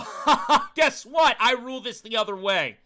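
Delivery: loud, high taunt with a mocking laugh; acted villainous gotcha; gloating mastermind. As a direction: gloat over the victim, laughing. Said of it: "you look at Sora in the eyes and you go".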